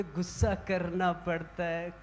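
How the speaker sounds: low, soft, unhurried denial of anger; imitating a serene guru, very quietly; smiling calm guru. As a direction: say it gently, completely unruffled. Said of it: "गुरुजी कहते".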